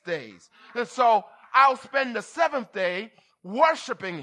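preaching at full force. Acted. Preacher urging the congregation to worship, preaching hard. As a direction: drive the point home to the church. Rising sermon on Sabbath worship.

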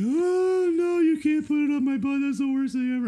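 put-on very high-pitched panicked kid wail; hammed-up horrified protest in a squeal; mock freak-out. Kid refusing dad's suppository, imitated hysterical panic. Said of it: "He about lost his mind".

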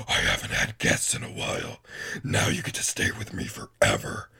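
very low demonic rasp with little voice; deep, menacing monster; changed monster voice. Acted. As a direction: growl it as a hulking creature claiming its prey. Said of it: "As soon as her voice changed".

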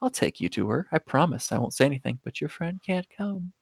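very soft, creepy coaxing; creepy suspect. Acted. Creepy suspect promising a detective a ride, softly creepy. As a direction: say it hushed, like a creep luring someone along. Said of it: "the creepiest person in existence role".

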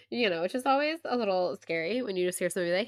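normal talking voice

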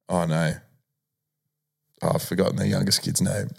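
ordinary talk

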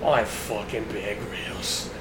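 put-on low, slow Australian accent; mock Australian survivalist, quiet and deep; drawn-out, hushed Australian narration; Bear Grylls. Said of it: "whatever that voice you were doing earlier to Australian".

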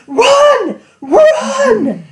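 high, loud panicked scream; screaming run. Retold or imitated. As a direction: shriek at them to flee. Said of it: "I'm like".

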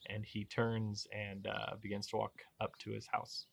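normal talking voice

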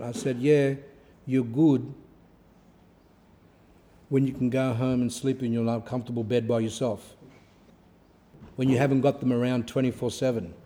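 ordinary talk, nothing marked

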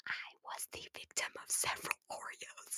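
hushed aside, barely voiced; whispered confession. Real, not acted. Admitting to viewers she was bullied, whispered. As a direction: admit it under your breath.

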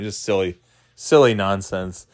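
ordinary delivery

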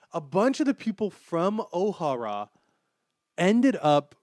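loud, thrilled announcement; excited loud. Real, not acted. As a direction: belt out the big reveal. Hyped reveal of the books.

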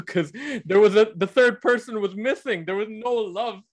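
high and laughing; amused, high-pitched insistence; laughing through it. Real, not acted. Laughing while arguing point.

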